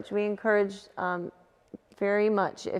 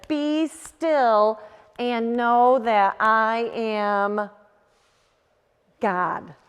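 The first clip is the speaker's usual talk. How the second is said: slow, solemn verse read out; slow scripture reading; 4 dB louder, much slower than usual. Shown as a demonstration. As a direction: let each word of the verse land slowly. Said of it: "Psalm forty-six, verse ten says".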